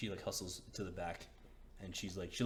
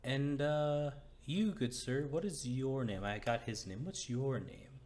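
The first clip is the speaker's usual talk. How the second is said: higher, louder woman asking names; curious woman; 6 semitones higher, 5 dB louder than usual. Acted. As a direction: ask brightly as the woman. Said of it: "She's like".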